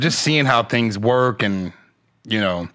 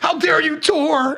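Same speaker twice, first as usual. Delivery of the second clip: much higher, slow, prissy indignation; mock outrage; slower than usual. Comic parodying outraged commenters, high and slow. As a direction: clutch pearls with it. Mocking the online critics.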